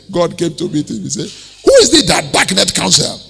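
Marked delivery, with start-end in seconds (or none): 1.6-3.2 acted indignant rebuke, rising in pitch; annoyed. God comes to rebuke Job, annoyed. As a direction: scold in mock exasperation, pushing louder. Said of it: "And that was where God was annoyed".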